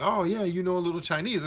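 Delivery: high-pitched imitation of a Chinese man's English; imitated Chinese accent. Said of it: "I'm imitating how he sounded".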